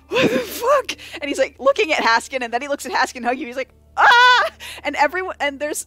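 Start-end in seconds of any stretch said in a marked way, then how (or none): 0.1-0.9 high-pitched horrified disbelief; shocked squeak. Acted. 4.0-4.5 loud, high "ah"; startled yell. Acted. Onlooker seeing the bear hug, startled yell.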